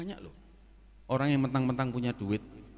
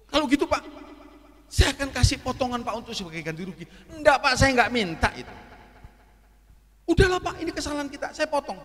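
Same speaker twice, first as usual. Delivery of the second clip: tawar-menawar sungkan; 10 semitones higher, 7 dB louder than usual. Retold or imitated. Dialog manajer dan tamu berebut menolak.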